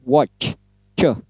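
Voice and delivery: "Watch" with a strong ch, then "Coh" alone; crisp, rounded ch on "Watch" and "Coh"; English ch sound. Shown as a demonstration. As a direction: isolate the ch burst from "Watch". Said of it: "seperti itu".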